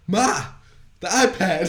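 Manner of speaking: loud, high, bratty demand to mom; mock demanding. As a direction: call out imperiously, laughing through it. Acting out demanding thing from mom.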